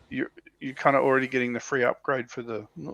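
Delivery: ordinary delivery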